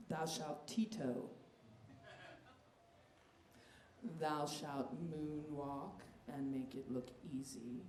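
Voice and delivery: mock commandments. Reciting mock commandments solemnly.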